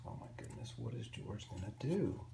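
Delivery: whispered, very soft worry; whispered suspense. A narrator's reading. Storyteller builds suspense for young listeners, whispering. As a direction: hush the room as if it's a secret.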